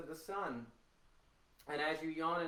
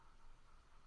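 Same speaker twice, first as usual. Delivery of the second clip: quiet unvoiced sigh; just breath, no voice; breathy sigh; 18 dB quieter than usual. Shown as a demonstration. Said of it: "sigh of breath".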